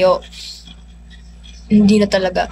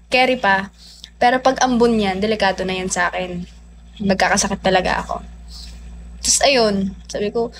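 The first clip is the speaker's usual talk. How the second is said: normal talking voice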